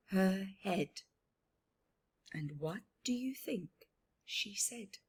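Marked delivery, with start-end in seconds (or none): 2.3-5.0 hushed question. Real, not acted. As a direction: build suspense softly.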